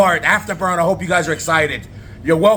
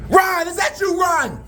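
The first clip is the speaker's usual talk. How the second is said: very high, surprised shout; high-pitched disbelief; astonished recognition; slower than usual. Acted. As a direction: cry out on suddenly recognising him. Recognising a familiar face.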